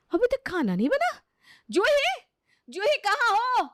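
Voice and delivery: high, loud startled call; shouting for Juhi. Acted. Sister-in-law shouting for the bride over the empty pots. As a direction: yell through the house for her. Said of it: "क्या हुआ जो इतनी ज़ोर से चिल्ला रही हो".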